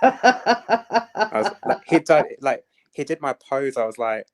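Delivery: high-pitched laughing while talking. Real, not acted. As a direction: laugh through the words. Laughing while telling the story.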